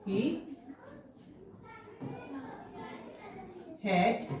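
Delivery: each word spaced out for writing; slow dictation. Shown as a demonstration. Teacher dictating to pupils, word by word and slow. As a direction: dictate one word at a time to the class. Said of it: "teacher dictating word by word".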